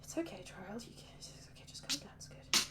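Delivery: muffled whisper to yourself; calm yourself down under your breath; whispered self-soothing. Acted. Trarel, face in hands, whispering himself calm. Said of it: "his face in his hands".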